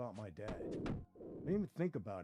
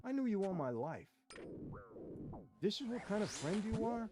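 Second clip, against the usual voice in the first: higher, hurt and accusing; confronting a lifelong friend; upset; 6 semitones higher than usual. Retold or imitated. To Jimmy in the car, betrayed and upset, raised pitch. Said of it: "I'm really upset with him".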